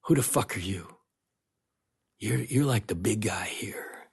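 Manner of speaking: hushed, half-whispered threat; imitating a soft, intimidating challenge; lightly voiced hushed menace; quiet menace. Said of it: "voice, they had to lean forward to hear him".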